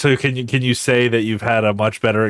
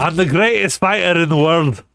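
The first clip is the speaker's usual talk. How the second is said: loud, higher Irish-tinged boast; Conor McGregor impression; 4 semitones higher, 3 dB louder than usual. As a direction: brag like a prizefighter.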